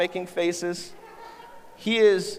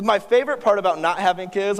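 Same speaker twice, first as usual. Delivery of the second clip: ordinary talk, nothing marked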